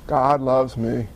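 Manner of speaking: low, slow, dejected mutter; mock downcast. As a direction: say it slowly and low, mildly mocking gloom. Mimics a doubting, downcast believer.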